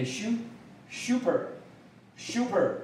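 British "super", starting with a "syoo" or "shoo" glide; British model of "super" for learners; British pronunciation. Shown as a demonstration. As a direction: say "super" slowly British-style, "SHOO-per". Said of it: "英国的发音是念".

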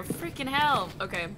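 normal talking voice

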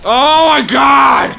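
frantic high scream of alarm; panicked shout. Real, not acted. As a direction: yell it out in a panic. Dodging a deadly boss attack.

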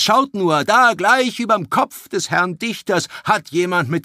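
a lightly voiced druid, admonishing and rising in anger; preaching druid. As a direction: warn the crowd like a stern sage. Druid's long speech. (mahnender Druide, zunehmend empört)

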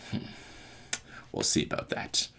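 very soft villain whisper; whispered threat. Retold or imitated. Villain over his unconscious foe, whispered threat.

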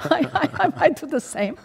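high, loud laughing confession. Real, not acted. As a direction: laugh it out, half joking. Laughing admission about Moses.

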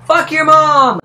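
very loud, high insult; hammed-up furious shout; rage yell. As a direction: scream it at the screen. Yelling at game character.

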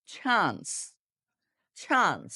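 চান্স begun with a puff, like ছ; aspirated ch. Shown as a demonstration. Teacher drills learners in practice, চান্স with aspirated ch. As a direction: say চান্স as airy "chhans", falling. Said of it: "চ যুক্ত ছ দিয়ে বলতে হবে".

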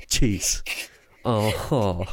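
ordinary delivery

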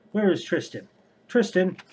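loud, high call across the house; shouting for sister. Acted. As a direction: bellow her name to summon her. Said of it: "shouted for his sister".

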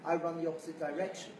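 normal talking voice

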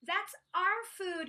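a mild indignant yell; yelling in protest. A narrator's reading. Apatosaur defending the shared food, yelling. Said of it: "yelled Apatosaur".

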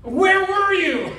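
high, slow and loud reproach; indignant scolding. Pretend. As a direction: demand it like a stern pastor. Pastor scolding absent churchgoer.

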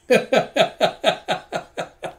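high, helpless run of laughter; cracking up. Real, not acted. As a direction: burst out laughing and keep going. Reader cracks up mid-line.

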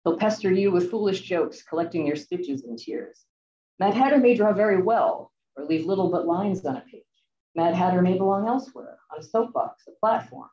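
normal talking voice

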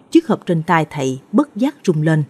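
ordinary delivery, nothing marked

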